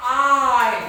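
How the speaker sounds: loud, drawn-out आई as a lesson model; long I. Shown as a demonstration. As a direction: carry the glide in आई long. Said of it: "लंबा ले जाना".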